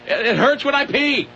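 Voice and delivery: put-on high, slow creaky old-man complaint; grumpy old man. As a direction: whine it like a cranky old man. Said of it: "what caption goes with that picture".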